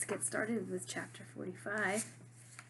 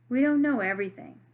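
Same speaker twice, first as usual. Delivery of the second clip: emphatic; 4 semitones higher, 12 dB louder, faster than usual. Acted. Marisol insists to Jackson.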